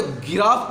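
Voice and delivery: गिराफ starting with g as in give; hard g misreading. Shown as a demonstration. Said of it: "जी तो गा पढ़े हैं".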